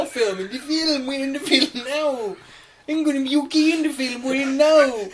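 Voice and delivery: high, slow, insistent daft voice; deluded film believer. Acted. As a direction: play a simpleton sure he's in a movie.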